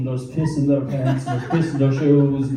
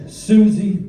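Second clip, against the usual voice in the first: raised, higher calling voice; calling a dog; 6 semitones higher, 6 dB louder than usual. Retold or imitated. Friend summoning his dog, raised calling voice. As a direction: call out to the dog.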